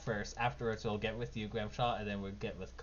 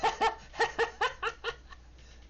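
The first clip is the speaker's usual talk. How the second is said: high gleeful cackle; delighted giggle at the hit; Nikki's laugh. Acted. Nikki laughs at the tumbling drow, high cackle. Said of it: "Nikki goes".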